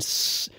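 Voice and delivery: drawn-out high-end S; sustained "sss" sound; sibilant hiss. Shown as a demonstration. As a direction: demonstrate sibilance with a long hiss. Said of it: "careful about our sibilants".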